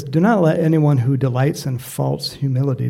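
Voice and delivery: loud, declaimed Bible verse; scripture reading. Real, not acted. Preacher reading the verse to his church, loud scripture reading. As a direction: read it out firmly to the congregation. Reads scripture aloud to congregation.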